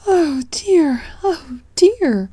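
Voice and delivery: high, sobbing oh dear; crying. Acted. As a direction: break into tears on each oh dear. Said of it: "cried Aunt Flounder".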